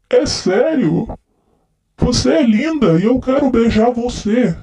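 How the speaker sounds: lightly slurred, tipsy declarations of love; drunk man. Acted. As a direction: play a drunk guy sweet-talking his friend. Nossa, eu tô muito bêbado.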